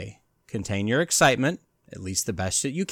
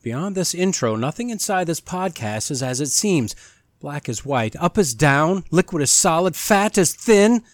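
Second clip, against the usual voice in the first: ominous announcer. Acted. Host welcoming listeners to a spooky podcast, theatrical announcer voice. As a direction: lure listeners in like a haunted house guide.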